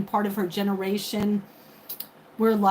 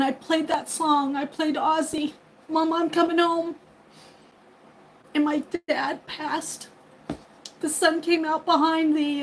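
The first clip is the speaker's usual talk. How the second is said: on the verge of tears; 4 semitones higher than usual. Real, not acted. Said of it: "I'm gonna cry".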